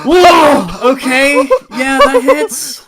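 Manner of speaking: amazed whoa. Real, not acted. Player stunned by the party's huge roll, surprised. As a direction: gasp at the big number. Reacting to a fifty-one roll.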